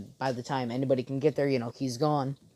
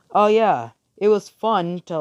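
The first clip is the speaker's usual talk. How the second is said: louder, higher acted realization; 6 semitones higher, 8 dB louder than usual. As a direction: raise pitch and volume as it dawns. Voicing a character's dawning realization.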